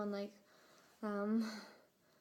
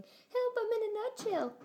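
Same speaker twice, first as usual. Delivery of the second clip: much higher tiny cry for help; tiny squeaky voice; 11 semitones higher, 4 dB louder than usual. Pretend. Punning on a nutshell, a tiny trapped creature squeaking for help. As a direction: squeak it like someone trapped in a nut. Sorry, I had to say it.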